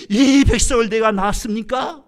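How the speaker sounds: loud, high cry of exasperation; exasperated protest. Acted. Moses complaining to God about the people, exasperated shout.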